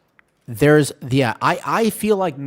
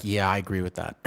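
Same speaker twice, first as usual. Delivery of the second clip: ordinary talk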